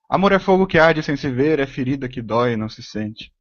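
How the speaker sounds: brisker verse reading, starting high and falling away. Shown as a demonstration. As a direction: recite it in one run, high at first, softening at the end. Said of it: "em várias velocidades, com várias entonações".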